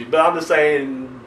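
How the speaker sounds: ordinary talk, nothing marked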